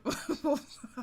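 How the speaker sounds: high embarrassed laugh. Real, not acted. Laughs at own mistake.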